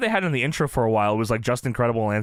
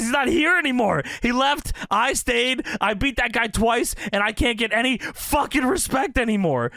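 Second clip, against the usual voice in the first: high, raised mock rant; mock screaming; 9 semitones higher than usual. Host reenacting Jerry Lynn yelling in front of the kid, high-pitched rant. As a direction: rant like a wrestler who feels disrespected. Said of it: "He's screaming this in front of this fucking kid".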